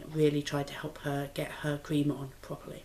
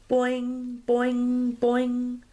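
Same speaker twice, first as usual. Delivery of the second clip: higher bouncy boing, grasshopper jumping; springy boing; 6 semitones higher, 4 dB louder, much slower than usual. A narrator's reading. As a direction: bounce each boing like a hopping insect. Grasshopper jumping sound in story.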